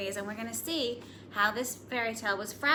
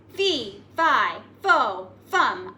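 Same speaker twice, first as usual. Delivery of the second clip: louder booming fee fi fo fum; giant's yell; 8 dB louder, much slower than usual. Acted. Giant yelling at hidden Jack, a louder booming chant. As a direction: bellow it word by word. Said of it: "I yelled".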